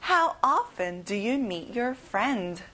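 very slow, each word laid out for the class; slow repeat-after-me. Shown as a demonstration. Teacher to students before they repeat, very slow model. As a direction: model the sentence slowly for learners to echo. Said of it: "큰 소리로 같이 따라해볼까요".

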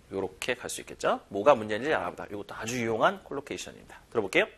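usual speaking voice

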